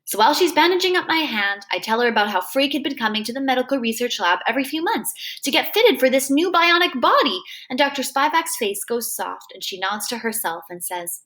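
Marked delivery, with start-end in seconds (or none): none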